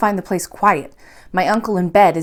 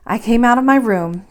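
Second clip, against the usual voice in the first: ordinary delivery, nothing marked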